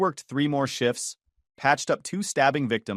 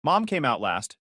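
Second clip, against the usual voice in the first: plain reading voice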